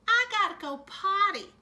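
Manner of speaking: put-on high, loud childish whine; child blurting out at story time, high and loud; whiny kid. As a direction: pipe up like an impatient little kid.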